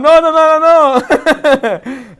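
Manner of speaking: high, loud comic protest; mock panic. Rabbi playing husband facing the big Nerf gun, mock panic. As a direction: plead in exaggerated alarm.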